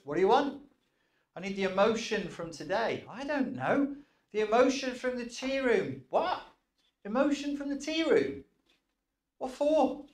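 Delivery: very high, puzzled little clerk voice; squeaky file clerk. Acted. Inner file clerk questioning the request, very high and puzzled. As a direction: pipe up high like a baffled filing clerk.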